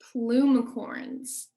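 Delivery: plumicorns said very slowly; slow word teaching. Shown as a demonstration. Teacher introducing a new word to children, plumicorns drawn out slowly. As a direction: draw out plumicorns for learners to copy. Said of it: "say that word with me".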